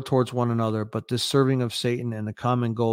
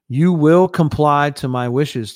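slow, loud, higher-pitched order; harsh command; 4 semitones higher, 8 dB louder, slower than usual. A narrator's reading. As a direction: lean hard on the words, harshly. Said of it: "Zelensky said harshly".

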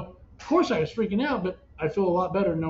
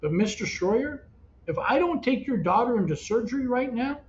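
retold doctor's grave warning, pitched up; raised, emphatic retelling of an ultimatum; urgent warning; 5 semitones higher than usual. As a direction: press each point, slowing on the last.

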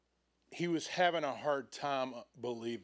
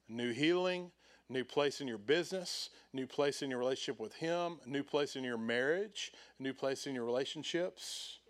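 ordinary talk, nothing marked

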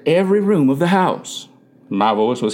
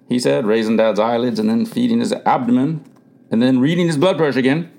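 usual speaking voice

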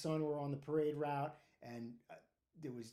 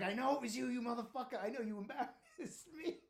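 higher-pitched retold outrage, breaking into laughter; mock angry accusation; 8 semitones higher than usual. Soaked fireman accusing his pranking buddy, mock-indignant. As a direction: imitate an indignant victim while cracking up.

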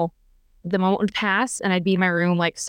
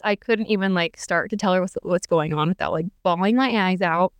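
ordinary talk, nothing marked

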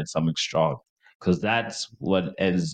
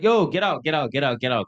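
urgent shooing; 7 semitones higher than usual. Retold or imitated. Clearing everyone from bathroom.

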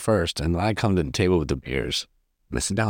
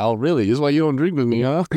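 mock sarcastic; 6 semitones higher, faster than usual. Retold sarcastic comeback.

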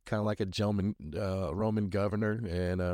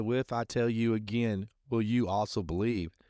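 ordinary delivery

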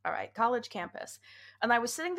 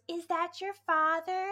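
higher, slow quoted question; a stretched-out, higher-pitched query rising at the end; imitated supervisor question; 8 semitones higher, slower than usual. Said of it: "the woman who was supervising me".

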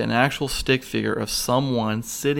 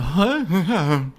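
disbelief; 6 semitones higher than usual. Retold or imitated. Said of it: "I'm like".

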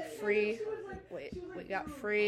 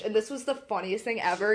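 ordinary delivery

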